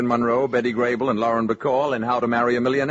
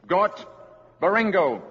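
a slightly raised, commanding call of alien words; alien command to robot; 8 semitones higher, slower than usual. Acted. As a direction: order the giant robot to stand down, firmly.

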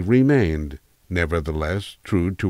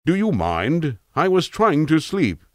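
cultured tramp; 10 semitones higher than usual. Acted. Woken tramp complains to youth.

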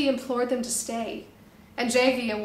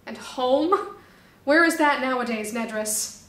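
louder, pleading shout; 2 semitones higher, 7 dB louder than usual. Acted. Ophis shouting at his brother Nedris, louder. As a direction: call it out across to your brother. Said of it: "shouted Ophis".

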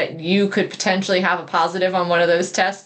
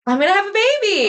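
high, quick burst of excitement; excited announcement; 13 semitones higher, faster than usual. Real, not acted. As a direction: burst out with big happy news, pitched way up. Announcing her pregnancy to co-host.

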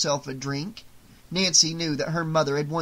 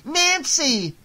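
high, loud call after her; shouting; 12 semitones higher, 4 dB louder than usual. Acted. Marge calling after her fleeing daughter, tearful shout. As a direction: cry her name as she runs. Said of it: "Marge shouted".

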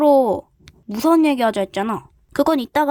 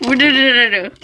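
growl; 7 dB louder than usual. Acted. Growling noise.

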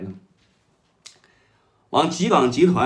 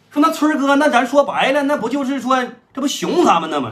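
higher, keen underling voice, ready to jump; eager Third Brother; 10 semitones higher, 5 dB louder than usual. Retold or imitated. Third Brother eagerly agreeing to his elder brother's call, high and keen. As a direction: sound only too glad to help.

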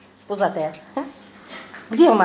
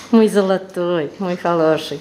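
usual speaking voice

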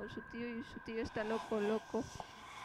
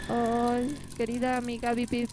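loud fond greeting; 9 dB louder than usual. Acted. Calls out to a friend in game.

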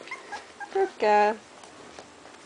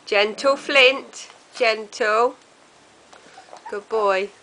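ordinary talk

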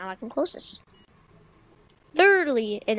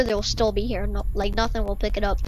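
ordinary talk

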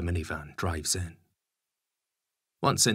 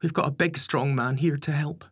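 high, louder woman's voice; high-pitched, easy reassurance; mother's voice; 8 semitones higher, 4 dB louder than usual. A narrator's reading. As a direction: reassure your husband in a lifted, high voice. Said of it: "Lisa replies".

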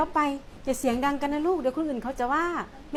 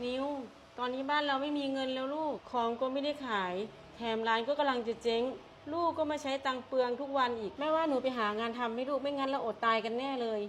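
1 gentle bad news; 3 semitones lower, 12 dB quieter than usual. Mother breaks bad news softly.

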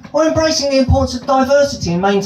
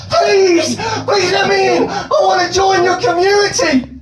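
desperate pleading; 12 semitones higher than usual. Pretend. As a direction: plead to be let in, near tears. Begging to be let in.

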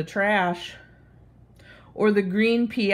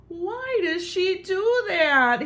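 higher, slow sighing question; 11 semitones higher, slower than usual. Acted. Splat sighing over Kitten's teasing, higher and slow. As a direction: let it out as a weary sigh. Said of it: "he sighed".

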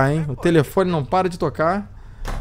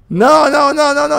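high, louder panicked no; 9 semitones higher, 4 dB louder, faster than usual. Acted. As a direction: plead in panic. Panicking at police.

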